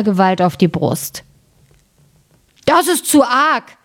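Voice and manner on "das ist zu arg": high, slow giant outcry; a giant fed up, crying out; mock giant outcry, higher and slow; angry giant cry. A narrator's reading. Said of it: "schrie der".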